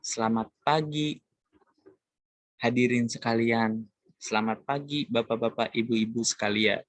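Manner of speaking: mild sermon-style welcome; sermon opening. Shown as a demonstration. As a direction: open the sermon to the audience, mildly formal.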